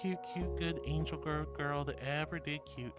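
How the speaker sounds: soft, mumbled admission under duress; grudging admission. Acted. Streamer forced by chat to admit she's cute, softly. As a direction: mutter it as if forced at gunpoint. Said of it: "I say this under great duress".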